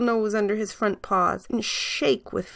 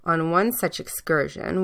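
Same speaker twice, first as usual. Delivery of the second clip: ordinary delivery, nothing marked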